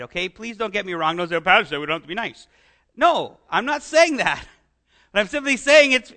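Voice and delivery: normal talking voice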